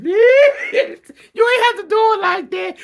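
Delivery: high, loud, cackling through the words; loud laughing disbelief, high; laughing shout. Real, not acted. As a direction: shout it while cracking up.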